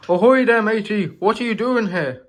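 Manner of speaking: high, loud hearty pirate hail. Acted. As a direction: call out like an old sea dog. Pirate-ish engine hails Dealey.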